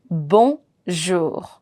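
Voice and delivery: bonjour, low, in two parts, ending on R; final R sounded. Shown as a demonstration. Teacher breaks down the greeting, sounding the final R. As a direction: carefully split "bonjour" and sound its last R. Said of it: "I'm pronouncing till R".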